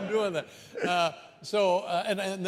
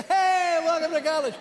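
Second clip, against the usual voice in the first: booming high welcome, much louder; loud cheery greeting; 12 semitones higher, 7 dB louder than usual. Retold or imitated. Student helper greets a new family, loud and cheery. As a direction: shout a hyped welcome at arriving cars. Same greeting, third lap.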